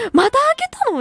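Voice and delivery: high, loud exasperated question; mock shocked dad. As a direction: play the startled father catching her again. Father scolding over new piercing.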